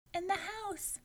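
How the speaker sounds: put-on high little girl's voice; small girl answering. A narrator's reading. Girl answering the giant, high and small. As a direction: pipe up like a small child. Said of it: "And Tutu Moo answered".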